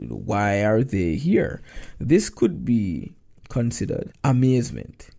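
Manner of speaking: ordinary talk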